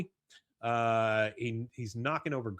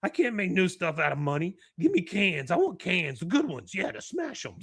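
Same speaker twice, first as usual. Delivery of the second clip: high-pitched, insistent character voice; hammed-up oddball demanding a treat; demanding Jimmy; 6 semitones higher, 4 dB louder than usual. Jimmy demands cans, not money.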